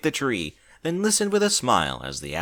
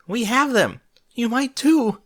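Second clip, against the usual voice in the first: frantic; 13 semitones higher, 5 dB louder, slower than usual. A narrator's reading. Frantic warning.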